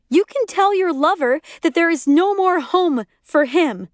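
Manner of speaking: screaming. A narrator's reading. Lori berating her friend over the phone, angry screaming. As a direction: lay into them in a high, angry voice. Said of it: "Lori continues screaming into my ear".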